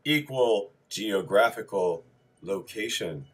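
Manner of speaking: mock-serious performance of a dry list as verse; interpretational poetry voice. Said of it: "best interpretational voice".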